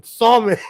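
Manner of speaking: salmon said SAL-mon, with the l; salmon with a clear l; spelled-out salmon. Shown as a demonstration. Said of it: "A L M O N".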